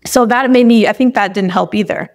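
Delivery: ordinary delivery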